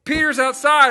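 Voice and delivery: high, loud, bursting with news; excited girl. Retold or imitated. Little girl Deborah telling the praying group excitedly. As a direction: blurt it out like a thrilled little girl. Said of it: "So she's so excited".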